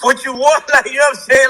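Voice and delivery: retold outburst broken up by laughs; exasperated quote, laughing through it; laughing exasperation. Man retelling how he questioned God, laughing exasperation.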